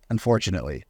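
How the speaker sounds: ordinary talk, nothing marked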